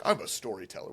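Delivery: low, soft narrator voice; storyteller voice. Retold or imitated. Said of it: "I like your storyteller voice".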